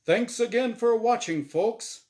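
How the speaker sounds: loud, high, cheery host voice; game show host. Acted. Game show host Azrael cheers Norm on, loud and bright. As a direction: boom it out like a TV emcee. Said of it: "Azrael said to the audience".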